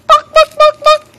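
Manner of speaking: high, loud, staccato clucks; chicken clucking. Pretend. Mother playing chicken for baby, high loud clucking. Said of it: "That's a chicken".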